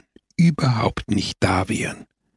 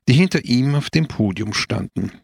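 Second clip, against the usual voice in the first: plain reading voice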